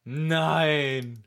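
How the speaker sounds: loud, drawn-out no; loud denial. Real, not acted. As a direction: refuse to believe it. Heftiger Widerspruch. (lautes ungläubiges Nein)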